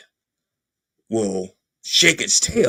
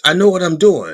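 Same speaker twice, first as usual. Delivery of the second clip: defensive insistence; 5 semitones higher, 6 dB louder than usual. Acted. Husband insisting he needs no plumber.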